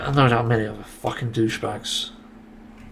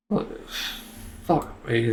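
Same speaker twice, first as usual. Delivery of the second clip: muttered curse; 2 semitones lower, 6 dB quieter, much slower than usual. Real, not acted. Disconnected mid-settings again.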